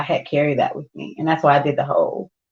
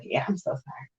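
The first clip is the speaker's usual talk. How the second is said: low, soft, imitated contrition; hushed retold apology; mock meek apology; 4 semitones lower, 13 dB quieter than usual. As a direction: drop right down into a sheepish sorry. Acting partner's apology after anger.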